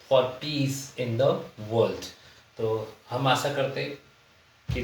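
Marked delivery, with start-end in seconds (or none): 0.1-2.0 slow example reading. Shown as a demonstration. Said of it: "teacher reads example sentence".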